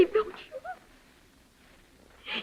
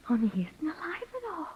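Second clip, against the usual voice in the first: whispered, much softer; eerie whisper; 11 dB quieter than usual. Acted. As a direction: breathe the words like a secret. Confessing the killing.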